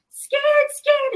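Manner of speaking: very high, loud toddler shriek in imitation; imitated terrified scream. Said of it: "started screaming, like, just terrifying".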